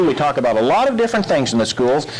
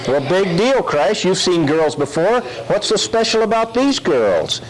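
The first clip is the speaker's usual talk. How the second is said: ordinary talk, nothing marked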